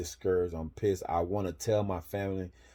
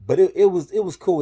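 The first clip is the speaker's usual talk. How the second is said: much higher, bright burst of relief; sudden happy lift; relieved delight; 12 semitones higher, 14 dB louder than usual. Real, not acted. Relief at the smaller place.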